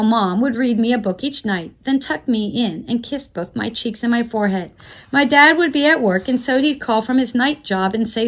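ordinary delivery, nothing marked

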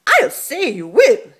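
high, loud snorting retort; snorting pig. Acted. Pig snorting at Martina's offer, loud and high. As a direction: snort it back at her. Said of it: "he snorted".

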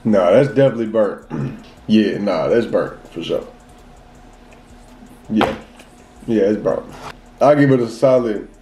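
speaking between bites; talking while chewing. Real, not acted. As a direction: mouth partly full of cookie. Seen 5: Bit cookie just before; holds bitten cookie, chewing and bites again.

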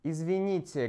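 ordinary delivery

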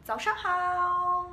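早上好 said slowly and high, each syllable drawn out; 早上好 stretched out slowly for the class; slow greeting demo. Shown as a demonstration. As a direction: teach 早上好 slowly, lifting the pitch. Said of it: "我是小老师".

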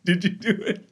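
high, slow cartoonish questioning; high goofy voice, laughing; silly pestering.

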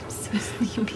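whisper. Pretend. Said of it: "She's like".

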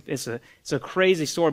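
ordinary talk

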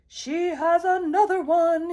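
loud, high holler. A narrator's reading. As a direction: holler it out like big news down the street. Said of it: "he hollered".

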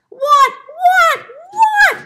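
high, loud, exasperated repeats; crying out. A narrator's reading. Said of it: "cried the fox".

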